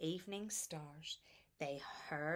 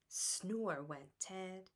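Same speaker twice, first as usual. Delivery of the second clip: ordinary delivery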